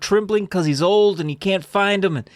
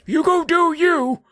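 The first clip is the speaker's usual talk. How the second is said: put-on huffy old man; 13 semitones higher than usual. Out of breath Steve gives up chasing his dog, high miffed old voice. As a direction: shrug off the dog, sulky. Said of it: "He's like".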